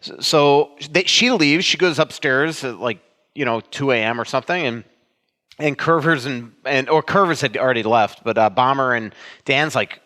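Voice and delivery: usual speaking voice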